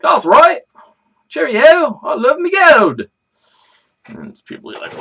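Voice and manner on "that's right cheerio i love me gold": hammed-up British accent pitched much higher and louder; mock British accent, high and loud. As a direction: play a jolly cartoon Brit. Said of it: "talking with a British accent".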